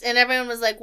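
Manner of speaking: ordinary delivery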